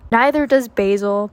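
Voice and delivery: usual speaking voice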